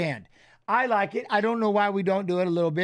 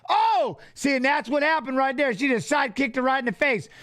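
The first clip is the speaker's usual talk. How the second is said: ordinary delivery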